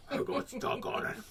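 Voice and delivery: high, strained unvoiced croak; deranged repetition. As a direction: squeeze it out like someone unhinged. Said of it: "You look deranged".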